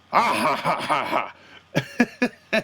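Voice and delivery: mock the boss's booming laugh; Vince laugh. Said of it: "That was terrible Vince impersonation".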